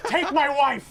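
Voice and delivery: high, loud panicked plea; hammed-up panicked shout; desperate yell. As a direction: beg loudly to be spared. Desperate victim pleading bit.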